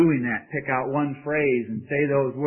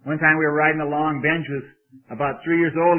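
usual speaking voice

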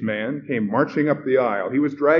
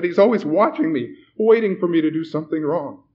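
high, loud, anxious outburst; blurted; 7 semitones higher, 6 dB louder than usual. A narrator's reading. Frightened man telling the host he's watched, blurted. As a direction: pour out the dread. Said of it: "the man blurted".

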